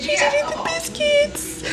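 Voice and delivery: very high, drawn-out celebration; gleeful squeal. Real, not acted. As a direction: squeal it slowly with glee. Celebrating child eating the biscuits.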